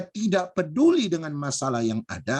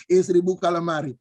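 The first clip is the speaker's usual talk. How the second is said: ordinary talk, nothing marked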